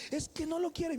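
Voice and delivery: high, pleading mock-crying voice; imitated tearful wail. Distressed pregnant sister to her brother, imitated crying. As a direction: act out a sister's crying outburst. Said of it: "Mi hermana lloraba".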